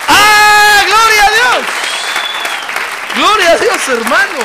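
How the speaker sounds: gloria shouted high and loud in praise; exultant shout. Real, not acted. Preacher praising God before the congregation, shouting with joy. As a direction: cry out in joyful praise.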